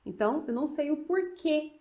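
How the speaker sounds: ordinary talk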